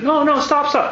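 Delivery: high, hammed-up begging; playful high-pitched plea to stop; mock pleading Pharaoh. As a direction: squeak it out like a panicked Pharaoh. Pharaoh begging in retold plague.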